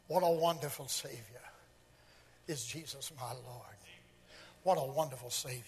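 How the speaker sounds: low, soft, hushed devotion; reverent refrain. Acted. Preacher praising his Savior between points, reverent and hushed. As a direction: drop it down low and quiet.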